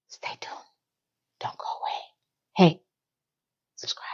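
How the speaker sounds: a breathless hushed whisper; whispered aside. Real, not acted. Host whispering to viewers while tearful, hushed. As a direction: slip the plug in under your breath.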